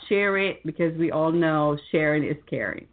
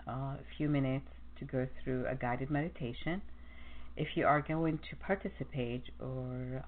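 soft radio host; 5 semitones lower, 12 dB quieter than usual. Acted. Soft intro to the meditation.

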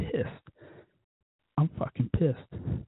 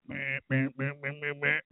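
mocking meh-meh; 5 dB quieter than usual. Imitating her nagging about Donald, mocking meh-meh. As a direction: bleat out a mock nag.

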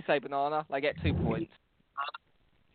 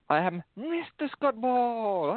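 mock announcer voice; 8 semitones higher, much slower than usual. Introduces himself with a put-on name.

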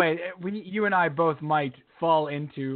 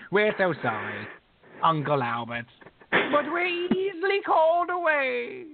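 slow, high, mock-posh British sing-song; slow British crooning parody; 8 semitones higher, slower than usual. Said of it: "could you be more British".

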